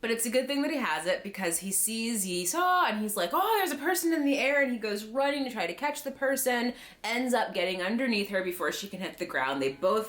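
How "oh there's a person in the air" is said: mock alarm. Acts out spotting someone falling.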